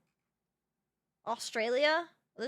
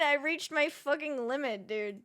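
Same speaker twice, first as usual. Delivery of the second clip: normal talking voice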